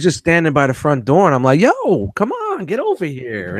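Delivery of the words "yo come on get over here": high call across the room, then slower; calling a guest over to join lunch, high and friendly. Retold or imitated. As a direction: call him over from the doorway. Calling him over at door.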